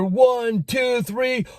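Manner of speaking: mock ring announcer counting the pin; referee count.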